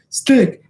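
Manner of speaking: sharp high stick noise; punch sound. Retold or imitated. Hearing punches connect, punch sound. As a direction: mimic the hit you heard from afar.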